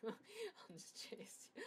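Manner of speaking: slow, creepy whisper. Acted. Sneaking up on Chloe, a slow creepy whisper. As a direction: whisper it slowly like a stalker.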